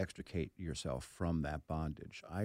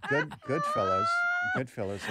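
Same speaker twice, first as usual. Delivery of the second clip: louder, higher, laughing through the title; laughing disbelief; 7 semitones higher, 8 dB louder than usual. Retold or imitated. Actor laughing at being mistaken for Ray Liotta. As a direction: repeat it in amused disbelief.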